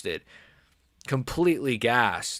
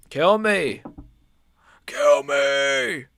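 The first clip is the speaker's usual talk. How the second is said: drawn-out, louder plea of mock despair; exasperated cry; 2 semitones higher, 4 dB louder, much slower than usual. Same plea, cried out louder.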